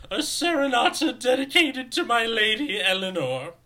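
high, loud, fluting fop; fabulous fop voice. Acted. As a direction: declaim it like a preening dandy. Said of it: "I have to say this in my, like, uh, fabulous fop voice".

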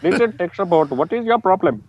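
high-pitched mock Indian accent. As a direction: do a convincing put-on Indian accent. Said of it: "That sounded real".

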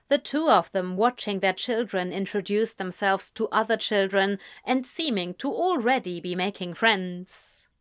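ordinary delivery, nothing marked

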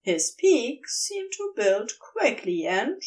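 ordinary delivery

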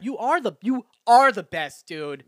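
loud, high, insistent hype; emphatic pep talk. Acted. Podcaster pumping themselves up, emphatic pep talk. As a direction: hype yourself up, hammering it home.

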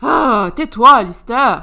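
plus fort, exaspéré; criant agacé. Acted. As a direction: faire taire Alistair en criant. Dispute entre amis. (Crystal crie sur Alistair)

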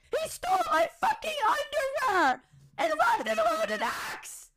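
high sobbing shout; high crying outburst about the stolen underwear; crying outrage. Real, not acted. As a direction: wail it through tears. Tearful outrage at HR.

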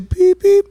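high-pitched ringtone beeps; two high little beeps; imitated phone beeps. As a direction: mimic a foreign dial tone. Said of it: "es de otro país".

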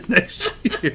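high laughing tail. Real, not acted. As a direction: laugh through the words.

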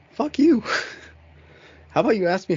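high, loud fuck you; angry outburst. Retold or imitated. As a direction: spit it back hard and high. Angry retort to rude partner.

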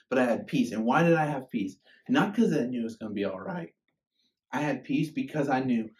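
impassioned preaching. Acted. Pastor telling his congregation about pain, fervent. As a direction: preach it with full conviction.

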